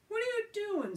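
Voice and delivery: high, sharp alarmed question. Acted. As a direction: caught off guard, alarmed. Alan alarmed.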